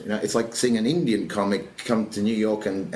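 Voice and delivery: usual speaking voice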